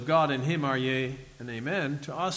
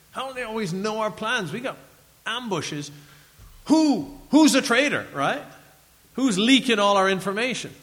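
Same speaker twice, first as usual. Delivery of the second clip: frustrated enemy king; 5 semitones higher, 5 dB louder than usual. Retold or imitated. Frustrated Syrians voiced in story.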